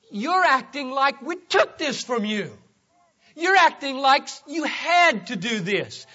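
preacher confronting Ananias, rising to a shout; fervent preaching. Acted. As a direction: drive the point home from the pulpit.

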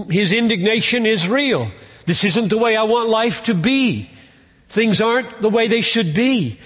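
earnest voiced complaint; impassioned lament. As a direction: let the frustration rise. Voicing bleak circumstances.

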